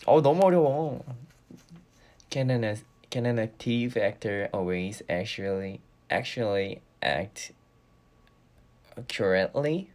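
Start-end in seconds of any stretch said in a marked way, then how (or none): 2.3-9.9 very slow, careful drill with a stumble; slow tongue twister. Shown as a demonstration. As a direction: creep through the words one at a time. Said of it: "발음 연습하거든요".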